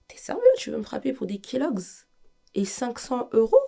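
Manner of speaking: incredulous protest. Retold or imitated. Disbelief at his threat.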